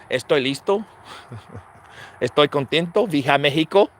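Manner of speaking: imitating Canelo in Spanish; a Canelo-style Spanish interview; Mexican Spanish. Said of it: "Canelo speaks good English".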